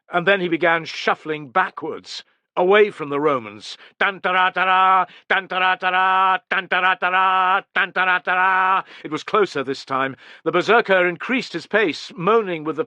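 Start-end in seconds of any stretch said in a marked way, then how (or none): 4.0-8.8 sung-out trumpet fanfare; imitated trumpet call. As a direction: voice the trumpets drawing closer. Trumpets closer.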